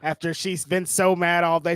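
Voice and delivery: ordinary talk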